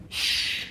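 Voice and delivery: soft unvoiced shush; librarian shushing whisper. Library quiet prompt.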